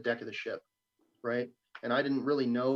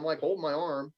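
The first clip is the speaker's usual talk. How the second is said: ordinary delivery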